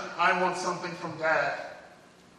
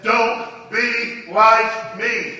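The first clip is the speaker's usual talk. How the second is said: very slow, drawn-out plea; 6 semitones higher, 13 dB louder, much slower than usual. Retold or imitated. Inmate warning the preacher, slow drawn plea. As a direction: stretch every word like a warning.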